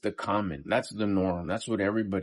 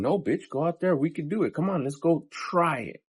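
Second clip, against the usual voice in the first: higher, pushy hype voice; a conscience urging you to get up and try; inner pep talk; 7 semitones higher than usual. Retold or imitated. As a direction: pump yourself up like a coach. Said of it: "When my conscience is saying".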